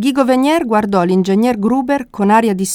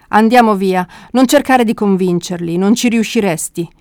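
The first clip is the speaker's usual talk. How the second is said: ordinary delivery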